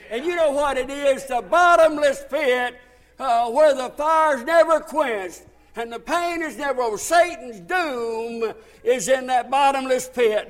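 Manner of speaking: rousing sermon delivery; forceful preaching. Real, not acted. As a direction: preach the doom hard. Preaches Satan's doom.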